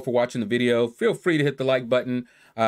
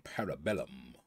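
Parabellum, rolled out much lower and softer; savoring the name; 10 semitones lower, 16 dB quieter than usual. Shown as a demonstration. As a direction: relish Parabellum low and deep. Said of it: "name that just rolls off the tongue".

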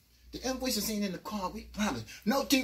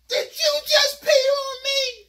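much higher, very loud, slow; outraged yell; 10 dB louder, slower than usual. Retold or imitated. Narrator yelling at the big dude. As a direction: shriek it in disgust. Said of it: "I'm like".

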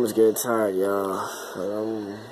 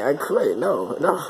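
ordinary talk

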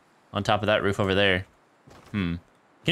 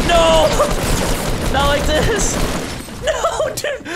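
real high yell of dismay, far louder; anguished shout; 6 dB louder, much slower than usual. Real, not acted. Streamer yelling at his missed crossbow shot, anguished shout. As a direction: scream it like the game just got stolen.

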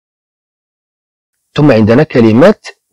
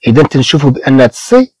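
ordinary talk

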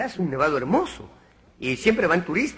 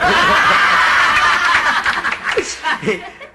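carcajada aguda y fuerte; 11 semitones higher, 6 dB louder than usual. Real, not acted. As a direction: soltar una carcajada a todo volumen. (carcajada aguda y fuerte)

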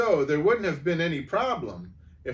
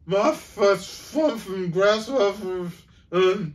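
slow, loud, higher sputtering; sputtered; 8 semitones higher, 8 dB louder, slower than usual. A narrator's reading. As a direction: spit the words out in a fluster. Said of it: "Rancid sputtered".

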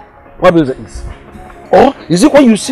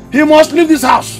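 higher, insistent order; angry ultimatum; 7 semitones higher than usual. Acted. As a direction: insist angrily, pitch raised. Father repeats ultimatum in anger.

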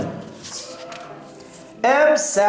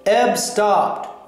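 plain reading voice

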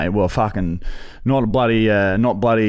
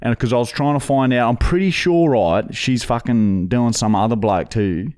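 lightly put-on rough Aussie bloke voice; a hint of a broad Aussie tradie; put-on bogan voice; 2 semitones higher than usual. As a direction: play a crude Aussie bloke on a prank call. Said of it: "this is my real voice. I don't even talk like that".

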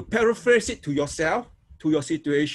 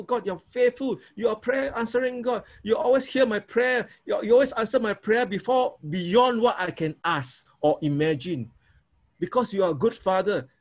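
higher, heartfelt praying voice; grateful prayer; 4 semitones higher than usual. Shown as a demonstration. Pastor showing the class how to praise God, higher fervent prayer. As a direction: pray it as thanks to a faithful father. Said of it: "You always talk like that".

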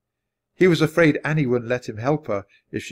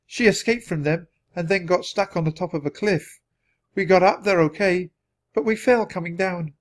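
higher, lightly voiced girl explaining; Annie's voice; 7 semitones higher than usual. A narrator's reading. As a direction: lightly pitch it up for a child's account.

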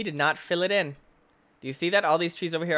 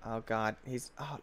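usual speaking voice

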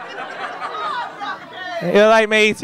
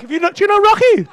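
mock asking around; 10 semitones higher, faster than usual. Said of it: "Walking past people like".

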